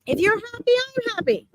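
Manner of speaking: high-pitched relay of a dad's words; imitated dad's insistence. Medium quotes dad to his married daughter, high insistent voice. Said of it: "he's literally saying".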